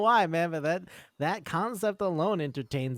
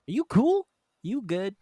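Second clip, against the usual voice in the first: awkward high check-in; 7 semitones higher, 4 dB louder than usual. Retold or imitated. Awkward boy checks on girl.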